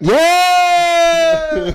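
a big high triumphant yell of joy; excited cheer. Real, not acted. Cheering after the correct quiz answer, a loud triumphant yell. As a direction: celebrate the win out loud.

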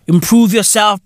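usual speaking voice

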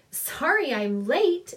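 lilting, sing-song call; sing-song fairy voice. A narrator's reading. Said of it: "a voice sang out".

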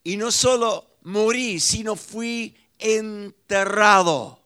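loud, rising, forceful sermon voice; fiery preaching. Acted. Pastor to his congregation, loud fiery preaching. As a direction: preach it out and hammer each ya.

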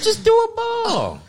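slow, very high, loud squeal of advice; mock frantic owner. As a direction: shriek hammed-up advice over the chaos.

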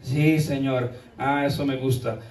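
meek low voice. Retold or imitated. Meek reply, then approving.

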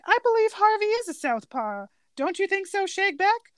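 lightly voiced cop; officer voice. Officer voiced in retold scene.

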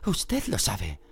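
high, slow, forced-calm voice; trembling but calm. A narrator's reading. Hermione trying to sound calm to Lupin. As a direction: hold a shaky voice steady. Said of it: "voz temblorosa que pretendía parecer calmada".

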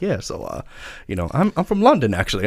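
a hint of a London accent, casual; British accent. Mocking the actor on a talk show, a hint of British accent. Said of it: "he goes on a talk show and he's like".

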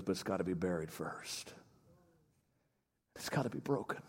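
very soft, low, solemn murmur; barely audible Southern voice. Retold or imitated. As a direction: imitate a mentor quietly imparting a secret. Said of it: "Southern drawl".

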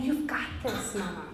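soft, hushed "You've got this, Mama"; tender whisper. Retold or imitated. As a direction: whisper it to a tired mother. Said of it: "he's whispering".

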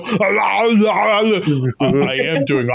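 hammed-up garbled groan, breaking into laughter; Arnold Schwarzenegger. Imitating Arnold protesting to his coach, strained mock groaning. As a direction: drag the words out into mock babble. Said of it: "You gotta go".

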